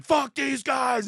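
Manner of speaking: drawn-out, high, loud yell; mock protest scream. Acting out a gas station protester, mock screaming. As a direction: scream it slowly like a one-person protest. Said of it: "screamed in everyone's face".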